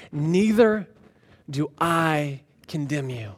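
low, soft, with pauses; gentle pardon. Acted. Jesus to the accused woman, tenderly letting her go. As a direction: sink slowly into quiet reassurance.

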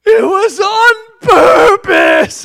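high, slow, loud sobbing shout; bursting into tears. Retold or imitated. Father imitating his daughter bursting into tears again. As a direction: wail it high and drawn out like a stubborn crying girl. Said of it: "She goes".

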